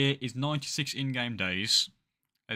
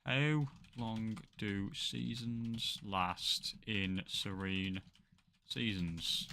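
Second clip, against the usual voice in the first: slow dictation; 6 dB quieter, much slower than usual. Real, not acted. Typing a search query aloud.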